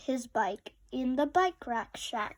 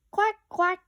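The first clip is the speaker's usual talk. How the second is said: quacking; 6 semitones higher, 7 dB louder than usual. A narrator's reading. Duck quacks again.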